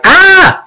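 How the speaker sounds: high, drawn-out mock shriek; little kid imitating his own scream; contained, high-pitched "ah" of fright; acted scream. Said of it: "When I saw him, I was like".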